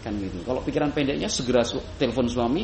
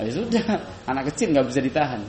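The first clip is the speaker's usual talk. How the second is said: usual speaking voice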